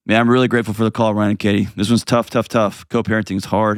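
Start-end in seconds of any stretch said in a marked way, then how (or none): none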